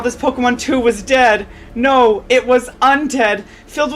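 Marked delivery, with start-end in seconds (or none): none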